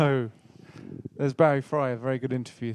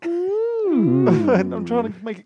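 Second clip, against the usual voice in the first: laughing; 5 semitones higher, 5 dB louder, slower than usual. Real, not acted. Laughing while rushing the show.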